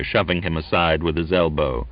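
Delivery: usual speaking voice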